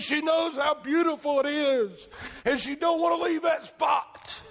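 rapturous preacher in full flow; impassioned preaching. Real, not acted.

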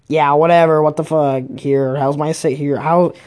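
ordinary talk

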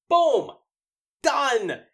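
loud, high, punchy exclamations; emphatic declaration. Acted. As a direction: punch each phrase like a verdict. Declaring the imagined apology finished.

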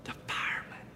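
put-on very soft little-boy whisper. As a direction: whisper the answer into the phone like a child. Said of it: "answers the phone with a whisper".

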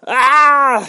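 high, loud, drawn-out mock scream; imitated scream. Hero pulling back the bow, imitated scream. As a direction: let out a long acted scream. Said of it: "Hero fucking screams".

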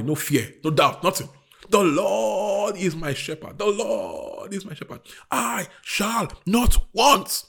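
loud, high preacher's cry, hammering each line; preaching at full force. Acted. Preacher urging his church to speak faith, full force. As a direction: preach it out to the congregation.

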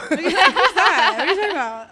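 high, laughing mock confusion; mock clueless. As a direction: play dumb, laughing. Acting out not knowing.

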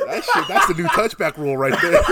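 laughing through a joke. Real, not acted. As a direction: chuckle out the punchline.

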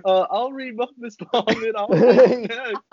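high, laughing eager volunteer; eager student, laughing. Retold or imitated. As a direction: beg to read, giggling. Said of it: "kept going".

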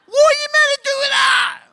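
high, loud cry of disbelief; exasperated shout. Real, not acted. Asking the congregation in disbelief, loud exasperated shout. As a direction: throw your hands up, shouting.

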